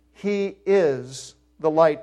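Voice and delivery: "He is the light." slow, each word pressed down; emphatic. Shown as a demonstration. Preacher insisting to the congregation, slow emphatic stress. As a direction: slowly hammer home "is" and "light". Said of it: "It's emphatic".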